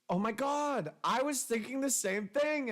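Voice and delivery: high, gushing relieved reaction; thrilled to hear it, voice lifted high; excited agreement. Retold or imitated. Acted phone breakup, relieved agreement.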